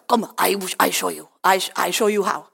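lightly mimicked Russian broken English; imitated Russian accent. Russian workmate showing a teenager the technique, Russian accent. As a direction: offer help in halting Russian-accented English. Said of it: "snakket kanskje to ord med norsk".

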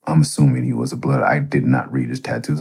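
ordinary talk

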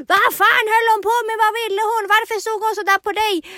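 high, loud jealous outburst; dramatiskt gräl. Retold or imitated. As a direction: re-enact a tipsy girlfriend's tirade, shouted and high. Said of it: "terroriserar honom med det här". (dramatiskt, högljutt svartsjukt utbrott)

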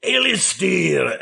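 put-on Scottish accent. Host greets a Scottish listener in a mock Scottish accent. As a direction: stretch "Alistair" the way a Scot would say it. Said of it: "as you would say".

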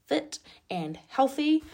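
air-quoted sarcasm. Retold or imitated. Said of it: "in quotation marks".

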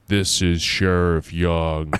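slow, low sheriff's words; said slowly. Acted. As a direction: draw it out calmly and low. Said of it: "he said slowly".

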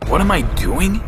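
angry, incredulous retort; angry snarl. A narrator's reading. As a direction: throw the question back angrily. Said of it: "I snarled angrily".

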